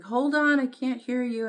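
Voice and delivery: yelling into a noisy phone; shouting over noise. Retold or imitated. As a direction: shout so they can hear you. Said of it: "and they're like".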